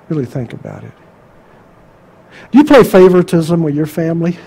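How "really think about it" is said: hushed appeal. Real, not acted. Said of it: "repeated appeal, quieter".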